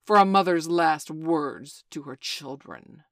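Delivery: loud, slow gloating proclamation; gloating call. Acted. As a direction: announce it with relish. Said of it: "he called gloatingly".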